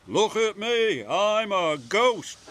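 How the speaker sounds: mock ghost. A narrator's reading. Engine pretending to be ghost.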